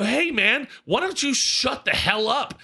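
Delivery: high-pitched mock telling-off; retold yelling. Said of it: "I'm yelling at this guy".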